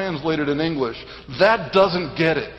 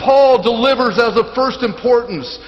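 raised, forceful sermon delivery; emphatic preaching; 7 semitones higher, 6 dB louder than usual. Real, not acted. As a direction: proclaim it with weighty emphasis. Preacher proclaims to congregation.

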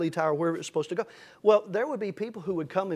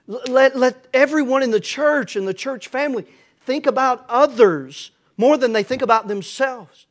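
loud, higher-pitched, emphatic sermon delivery; full-force preaching; 4 semitones higher, 9 dB louder than usual. Real, not acted. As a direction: preach it out forcefully to the whole church.

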